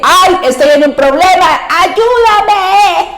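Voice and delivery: very high, whimpering plea for help; plaintive cry. Acted. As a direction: wail it like a helpless child. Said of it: "Es un grito lastimero". (grito lastimero, agudo y quejumbroso)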